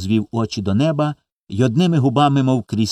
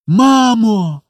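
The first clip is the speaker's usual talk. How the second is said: put-on loud, high, joyful child's shout; cry of joy; 12 semitones higher, 5 dB louder than usual. A narrator's reading. Children rush to the door believing mother came, joyful cry. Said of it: "з криком радості".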